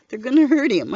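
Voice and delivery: retold anxious yell, loud and high; alarmed yell. A narrator's reading. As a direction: cry out in alarm to protect him. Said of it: "I yell at them".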